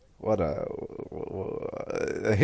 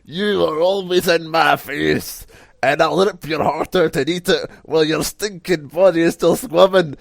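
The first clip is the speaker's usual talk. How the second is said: strangely intense, loud high wrestler's threat; hammed-up promo rant, high and loud; Drew MacDonald; 9 semitones higher, 11 dB louder than usual. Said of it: "intense he is, but in, like, in a strange way".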